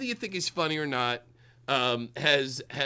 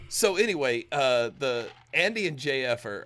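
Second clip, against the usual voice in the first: ordinary delivery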